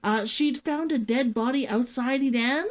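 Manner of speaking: normal talking voice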